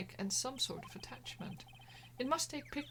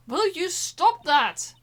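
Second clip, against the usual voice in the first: loud, slow, higher snap; angry shout; 6 semitones higher, 16 dB louder, much slower than usual. Real, not acted. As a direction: yell at him to stop. Annoyed at repeated noise.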